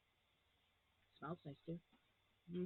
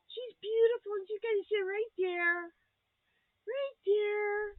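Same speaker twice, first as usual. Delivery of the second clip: excited; 10 semitones higher, 6 dB louder, slower than usual. Real, not acted. Showing gift to viewers.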